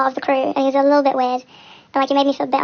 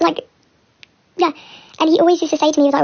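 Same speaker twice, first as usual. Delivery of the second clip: usual speaking voice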